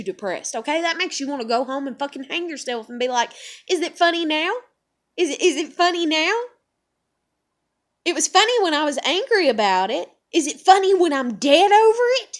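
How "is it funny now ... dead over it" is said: loud, high-pitched, hurried challenge; angry confrontation. Acted. Angry worker confronting the manager who snickered at her, furious challenge. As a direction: demand an answer, rising higher and louder.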